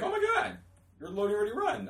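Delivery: high-pitched imitated gush; starstruck fangirl. New hire meeting her manager at McDonald's, starstruck. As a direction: squeal lightly like a starstruck new employee.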